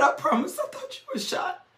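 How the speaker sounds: high, soft, choked-up retelling; small high voice on the edge of tears; imitated tearful voice. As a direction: imitate a friend crying in relief and shock. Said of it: "and she's crying".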